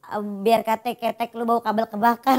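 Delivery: gruff father. Acted. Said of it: "lagi adegan drama".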